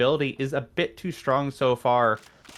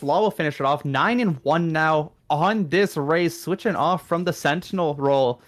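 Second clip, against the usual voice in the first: ordinary talk, nothing marked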